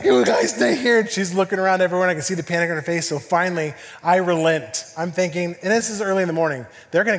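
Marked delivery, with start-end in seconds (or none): 0.0-1.0 high, loud panicky order; panicking wife. Retold or imitated. Wife tells the kids while panicking, high urgent voice. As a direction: imitate a mother starting to panic. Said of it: "my wife's starting to panic".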